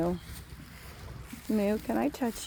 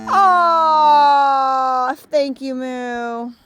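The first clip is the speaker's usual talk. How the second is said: much higher, much louder gushing; 6 semitones higher, 13 dB louder, much slower than usual. Real, not acted. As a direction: melt over the cow out loud. Gushing over the cow.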